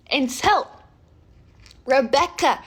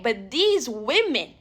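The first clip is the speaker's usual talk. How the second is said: ordinary talk, nothing marked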